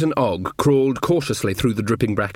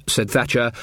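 ordinary delivery, nothing marked

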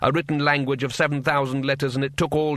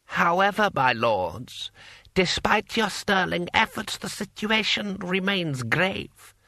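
higher, slow formal address; smooth scheming lord; 6 semitones higher, slower than usual. A narrator's reading. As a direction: announce grave news to rival lords with control. Lord Hong addresses the warlords.

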